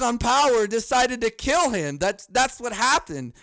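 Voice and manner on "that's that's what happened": normal talking voice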